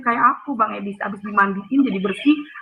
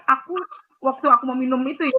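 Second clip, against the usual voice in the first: normal talking voice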